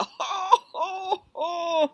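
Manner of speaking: high, loud roaring laugh; roaring laughter. Acted. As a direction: roar with laughter. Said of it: "He roared with laughter".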